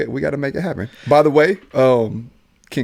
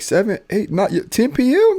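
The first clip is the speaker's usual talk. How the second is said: mock disbelief; 7 semitones higher than usual. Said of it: "I'm like".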